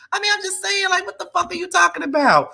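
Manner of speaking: high, mocking girlfriend voice, yelling at him. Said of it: "This is what [name]'s saying to [name]".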